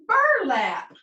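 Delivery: "Burlap" as one word, high then dropping; blended whole word. Shown as a demonstration. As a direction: call out "Burlap" joined up for the class. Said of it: "Read it all together".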